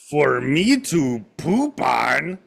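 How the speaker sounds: very slow mock South American-ish accent; hammed-up South American-ish puppet voice; slow mock Triumph. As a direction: stretch it out like the puppet dog. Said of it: "he would talk like this".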